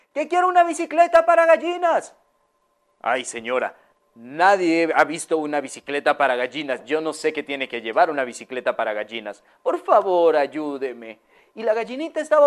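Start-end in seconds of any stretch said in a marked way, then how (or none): 0.1-2.1 high, loud repeat; repeating louder. Acted. Owner repeating her request louder to the deaf vendor, high voice. 3.0-11.2 vendor. Acted. Vendor replies.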